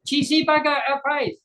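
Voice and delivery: high imitation Chinese accent saying Cheesy burger; mimicked Chinese-English accent. Mimicking cafe lady's accent.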